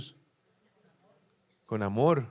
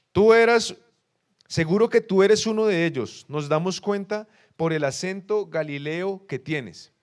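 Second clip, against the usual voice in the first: acusación imitada, fuerte y aguda; acusación dramatizada; 6 semitones higher, 10 dB louder than usual. Retold or imitated. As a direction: acusa en voz alta, como representando la escena. Dramatiza la acusación contra Pedro. (acusación en voz alta)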